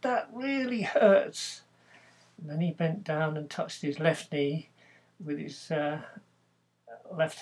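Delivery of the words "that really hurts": whining complaint. Retold or imitated. Harry complains to the doctor.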